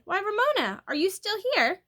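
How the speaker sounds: high, loud, startled question; surprised. A narrator's reading. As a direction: ask in sudden surprise. Secretary finds forgotten Ramona.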